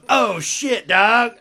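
loud, high surfer dude voice. Retold or imitated. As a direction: yell it like a cartoon skater. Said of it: "they're just like".

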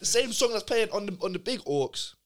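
teasing him, voice up; excited. Real, not acted. Mocking friend singing along.

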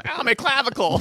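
high mock-pained kid voice; hurt kid. Kid hears a crack, mock pain. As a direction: yelp it like a dorky child.

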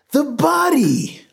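very high, drawn-out hysterical cry; screaming. A narrator's reading. Desperate man to the gathering crowd, screaming. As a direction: scream it, pitch at the top. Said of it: "Lois screamed".

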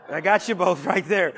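chuckling through the words to the congregation; a real laugh breaking into the line. Real, not acted. Pastor pointing out his mother and daughter, laughing.

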